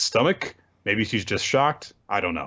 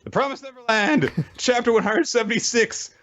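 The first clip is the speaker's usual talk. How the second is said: giddy excited intro; 11 semitones higher than usual. Real, not acted. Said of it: "excited cheery show intro".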